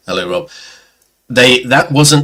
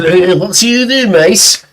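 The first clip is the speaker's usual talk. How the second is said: loud, gruff mock Scouse, pitched up; gruffer Liverpudlian accent; 11 semitones higher, 4 dB louder than usual. Drunk local challenging the photographer, in gruff Scouse. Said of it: "no, it's more gruff".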